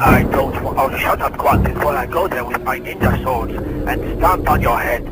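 menacing cartoon villain; villain voice threat. Villain voice threatening the kid on the line. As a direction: growl the threat like a ninja baddie.

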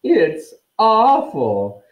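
slow, high-pitched relish; drawn-out ghostly delight; gleeful. A narrator's reading. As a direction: relish the bad news, stretching each word high and loud. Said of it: "never seen her looking so happy".

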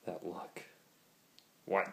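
ordinary delivery

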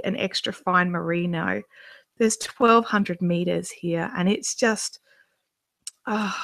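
ordinary delivery

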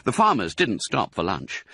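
ordinary delivery

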